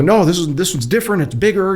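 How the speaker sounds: high-pitched mimic of worried insistence; retold frantic insistence. Wife tells doubting husband this one's different, imitated frantic insistence.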